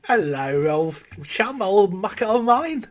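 cheery London accent, lightly put on. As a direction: greet your old mate in a London accent. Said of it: "You sound like you're from London".

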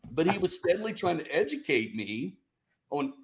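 ordinary delivery

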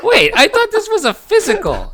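high-pitched, laughing mock protest; mock shocked patient. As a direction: play a baffled patient, voice jumping up. Acting out shocked patients at doctor.

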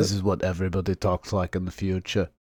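half-Swedish, half-Scottish put-on accent; future accent. Time traveller insisting this is how people talk. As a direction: blend of odd European accents. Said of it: "a little bit, like, Swedish".

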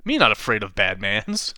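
usual speaking voice

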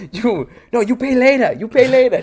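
high, loud sing-song warning; mock warning voice. Answering China fans with a high, loud taunt. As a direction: wag a finger with a high taunting voice.

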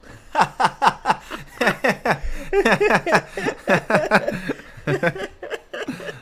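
bursts into laughter; laughing. Real, not acted. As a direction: laugh hard at the joke. Laughs at own quoted joke.

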